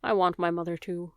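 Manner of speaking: usual speaking voice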